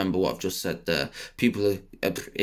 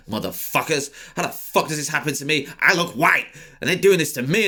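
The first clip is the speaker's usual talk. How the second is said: exasperated rant; 6 semitones higher, 3 dB louder than usual. Retold or imitated.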